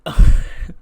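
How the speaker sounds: loud breathy villain laugh; sinister laugh. Retold or imitated. Aleister laughing before his promo, loud villain laugh. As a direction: cackle like a menacing villain. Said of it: "Aleister goes".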